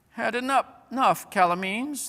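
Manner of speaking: high, loud mocking yell; jeering yell. A narrator's reading. Dwarfs jeering at the beaten Calamines, yelling. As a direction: taunt them at full voice. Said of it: "they yelled".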